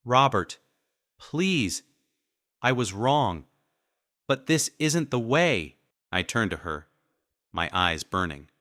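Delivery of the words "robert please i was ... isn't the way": higher, tearful pleading; wife sobbing at husband; sobbing plea. A narrator's reading. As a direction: beg through tears. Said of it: "Judy sobbed".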